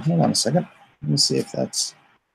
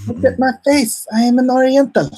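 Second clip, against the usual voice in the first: much higher, louder mock outrage; mock indignant; 12 semitones higher, 5 dB louder than usual. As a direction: protest in hammed-up offence.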